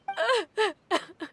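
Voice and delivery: a short, high, cold laugh. Acted. The Knave laughing after her sentence, cold laugh. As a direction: laugh once, unmoved by the plea.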